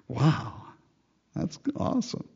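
a light, airy pleased God; God's delighted wow. Pretend. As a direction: voice the Lord delighted at the fruit. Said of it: "what fruit is he gonna go".

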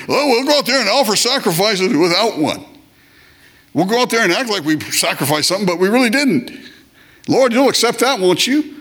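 mock pretend worshipper. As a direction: play a smug faker hoping God accepts.

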